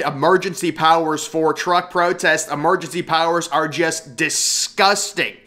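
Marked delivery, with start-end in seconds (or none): none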